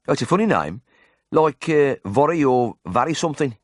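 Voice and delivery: high Brummie voice searching for a word; puzzled patient in broad Birmingham; Birmingham accent. A narrator's reading. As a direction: grope for the name in ripe Brummie. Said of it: "in a ripe Birmingham accent".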